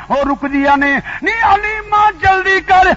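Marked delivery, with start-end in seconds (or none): 1.2-2.9 high, slow call to a lagging friend. Retold or imitated. Friends calling Halima to hurry. (ਉੱਚੀ ਆਵਾਜ਼ ਵਿੱਚ ਸਹੇਲੀ ਨੂੰ ਪੁਕਾਰਨਾ)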